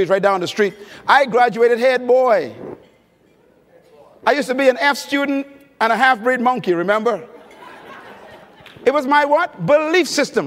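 ordinary talk

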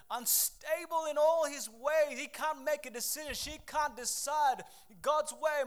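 fiery pulpit delivery; preaching at full force. Acted. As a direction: preach it out to the congregation.

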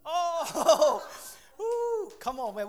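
loud, high laughing yelp. Retold or imitated. Preacher laughing at his own birthday blunder. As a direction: laugh away an embarrassing slip.

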